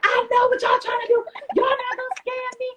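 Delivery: high, loud furious yell at the staff; retold scream. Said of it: "I started screaming".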